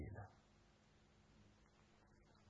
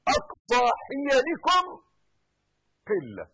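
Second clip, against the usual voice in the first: loud, high, rising proclamation; fiery preaching; 13 dB louder than usual. Acted. Preacher proclaiming the truth to believers, loud. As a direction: drive the truth home to the faithful. Sermon climax at full force.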